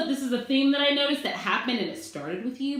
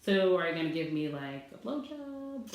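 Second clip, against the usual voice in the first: sarcastic inner thought; 5 semitones lower, 7 dB quieter than usual. Retold or imitated. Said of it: "all I could think in my head was".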